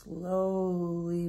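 long, lingering vowel on slowly; drawn-out slowly. A narrator's reading. Drags the word to picture glacier.

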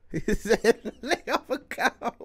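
words broken up by giggles; laughing while talking. Real, not acted. Reactor repeating a line from the show, helpless laughter. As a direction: try to talk through the laughter.

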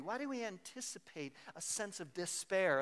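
ordinary talk, nothing marked

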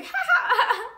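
high, loud burst of laughter; acted social laugh. Chilling and laughing with somebody in V I P, acted laugh. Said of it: "I'm laughing with somebody".

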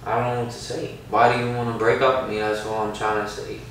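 low, subdued bewilderment; real dejection. Real, not acted. Boyfriend hears he is being dumped, real dejection. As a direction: plead low, at a loss for words.

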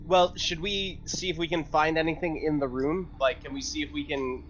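normal talking voice

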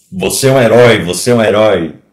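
loud, emphatic proclamation; mock-solemn loud praise; mimicked medal-giver. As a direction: declare it like an official handing out honours. Mimics man awarding a medal.

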